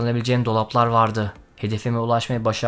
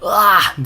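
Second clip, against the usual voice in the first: loud sudden cry, higher; cry of shock; 6 semitones higher, 7 dB louder than usual. A narrator's reading. Son watching the neighbour shot, loud startled cry. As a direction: let out a startled yell. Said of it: "diye bağırdım".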